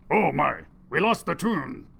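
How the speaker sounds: usual speaking voice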